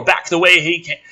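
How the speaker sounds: usual speaking voice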